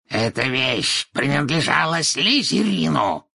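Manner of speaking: high, emphatic, drawn-out cry; fervent boast at high pitch; shouted out. A narrator's reading. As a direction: cry out the proud claim slowly. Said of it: "выкрикнул Мракс".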